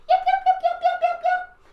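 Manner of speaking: very high loud yapping; shrill little yips and ruffs; little yappy dog. Shown as a demonstration. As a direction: yap like a tiny dog. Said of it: "be the little yappy dog".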